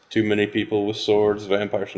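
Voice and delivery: normal talking voice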